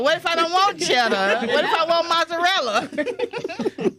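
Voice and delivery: hammed-up kid arguing about cheese; mock sassy daughter. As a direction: daughter sassing dad about cheddar, hammed up. Mimicking daughter arguing with dad.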